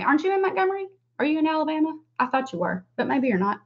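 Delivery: usual speaking voice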